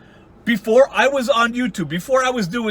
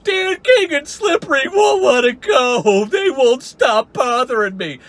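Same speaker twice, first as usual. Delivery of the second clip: much higher, slow hammed-up whine; mock wailing; 6 semitones higher, slower than usual. Mocking Wally crying on the floor, high and slow. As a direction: wail like a beaten man on the floor. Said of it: "Wally's on the floor going".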